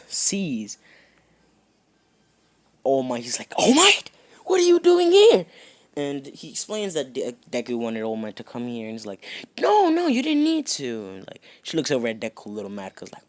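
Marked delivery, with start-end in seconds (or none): 3.6-5.4 much higher, loud surprised yelp; startled mother shrieks the guest's name; shocked mom cry. Acted. Mom surprised at All Might's visit. 9.6-11.1 flustered mom. Acted. Mom protesting to All Might.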